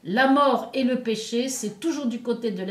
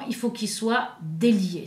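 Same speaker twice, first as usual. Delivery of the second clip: usual speaking voice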